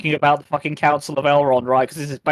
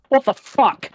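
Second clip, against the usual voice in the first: high, incredulous exclamation; retold disbelief; 8 semitones higher, slower than usual. Recalling waking after long sleep, retold disbelief. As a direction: pitch jumps up in baffled outrage. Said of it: "I was like".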